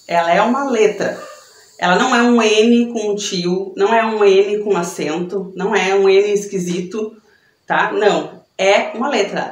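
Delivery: ordinary delivery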